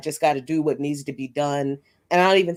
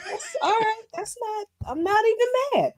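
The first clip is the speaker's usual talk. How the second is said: laughing. Real, not acted. Laughing at the rhyme.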